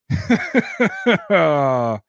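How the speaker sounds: short bitter laugh at the bad news; loud rueful chuckle. Real, not acted. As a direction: laugh it off in disbelief.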